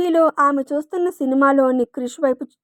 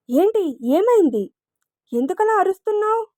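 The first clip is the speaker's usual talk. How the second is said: flustered; 6 semitones higher than usual. A narrator's reading. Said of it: "అతను కంగారు పడుతూ".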